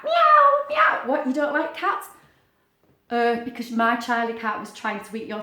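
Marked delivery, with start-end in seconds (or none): 0.0-1.0 high, loud mewing cat; cat meow. Pretend. Teacher reads the animals to children, high loud meow. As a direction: meow like a kitten, much higher and louder. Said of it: "And a cat".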